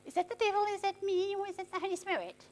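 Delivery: a believer softly second-guessing which voice it is; mock unsure questions. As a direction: wonder aloud under your breath. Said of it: "You won't be able to say".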